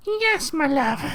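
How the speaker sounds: very high, breathy man's voice. Acted. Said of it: "a man who speaks in high-pitched, breathy tone".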